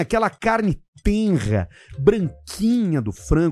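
slow, lingering food description; savouring. Acted. Host describing the sponsor's chicken, slow savouring. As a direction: linger lovingly over each word.

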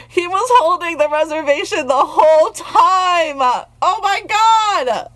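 high gushing squeal; excited squeal. Real, not acted. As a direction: gush like a fangirl. Gushing over romantic gesture.

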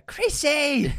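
high, loud gleeful yell; excited shout. Real, not acted. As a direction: shout it with glee.

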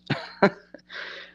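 breathy chuckle, mostly without voice; short chuckle. Real, not acted. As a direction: chuckle at your own phrase. Laughs after saying psychic abuse.

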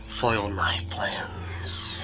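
very slow, hushed villain whisper; menacing whisper. Acted. Electric creature accusing the hero who foiled it, menacing whisper. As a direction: draw it out in a sinister whisper.

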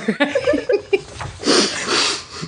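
long, voiceless laughing fit; real wheezy giggles; wheezing laughter. Real, not acted. Reader cracking up at the space joke, wheezing laugh.

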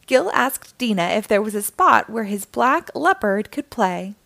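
ordinary delivery, nothing marked

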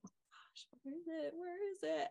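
whispered start, then high and very soft; re-enacted nervous waiting, barely audible; anxious hushed muttering. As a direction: fret under your breath, voice thin with impatience. Acts out anxious waiting for results.

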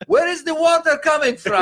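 hammed-up angry neighbor shouting through laughter; baffled Armenian neighbor. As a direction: squawk it like a bewildered old man. Neighbor confused by rain.

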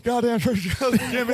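Stone Cold impression. Joins the Stone Cold bit.

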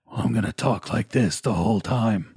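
lightly mock the actor's tough-guy law voice; imitating gruff Dredd voice. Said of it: "doing this gruff American voice".

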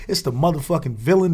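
normal talking voice

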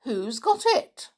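very high, loud startled questions; amazed, squeaking-up questioning; astonished exclamation. A narrator's reading. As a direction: demand it in high-pitched surprise. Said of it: "exclaimed Sammy".

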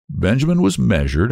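ordinary delivery

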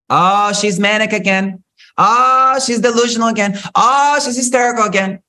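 high, sneering mock gossip; mimicking people who call her manic; mocking dismissal. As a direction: sneer at her like a judgmental onlooker.

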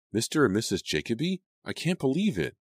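high-pitched, stunned reaction; shocked disbelief. A narrator's reading. Girl hearing friend's parents are separating, shocked disbelief. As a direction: voice climbing in disbelief.

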